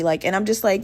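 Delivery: normal talking voice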